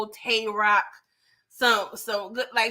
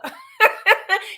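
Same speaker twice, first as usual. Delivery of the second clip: loud high laugh; 8 semitones higher, 5 dB louder than usual. Real, not acted. Vlogger cracking up at her own joke, loud laugh.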